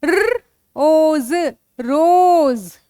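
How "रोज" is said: slow blending. Shown as a demonstration. Teacher blends sounds into word.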